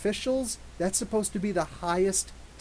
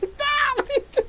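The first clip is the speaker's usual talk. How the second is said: much higher, louder, laughing scold; half-laughing panic at the wet kid; high frantic mock scolding; exasperated mom; 7 dB louder than usual. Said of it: "I'm like".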